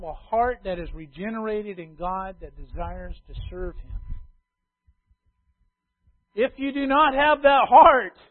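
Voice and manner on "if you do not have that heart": loud and higher warning; loud pulpit warning; preaching. Acted. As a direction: press it hard on the listeners. Warning the congregation.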